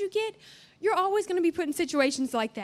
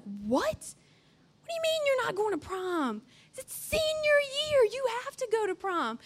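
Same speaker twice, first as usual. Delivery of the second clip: lightly voiced shocked mom; 6 semitones higher than usual. Mom disbelieving he skips prom.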